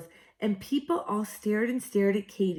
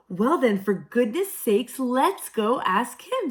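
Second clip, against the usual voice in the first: louder, eager little voice, slowing at the end; 4 semitones higher, 6 dB louder than usual. A narrator's reading. Freddie urging Katy, louder and eager. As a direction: push the idea brightly.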